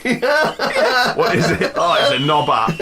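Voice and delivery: slow, drawn out through laughter; laughing. Real, not acted. Laughing at the punchline.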